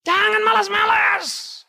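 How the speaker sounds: high, loud and slow shout; shouted exhortation. Real, not acted. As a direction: bellow it at the lazy. Preacher exhorting the congregation. (pengkhotbah berteriak lambat menegur jemaat yang malas)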